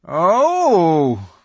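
loud, high drawn-out "oh"; scornful beaver. Acted. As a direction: scoff loudly, as a beaver character. Mister Beaver scoffs at the witch.

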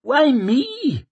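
high, squeaky panic; squeak. A narrator's reading. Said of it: "squeaked Swarbrick".

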